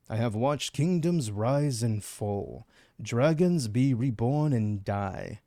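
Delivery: slow, solemn reading of ancient vampire lore; dramatic reading. Acted. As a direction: intone it like an ancient vampire reflecting. Reads game text dramatically.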